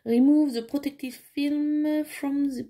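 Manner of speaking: slow English in a French accent; English notice read slowly, French-accented; self-mocked French accent. Real, not acted. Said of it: "attention, mon accent d'enfer".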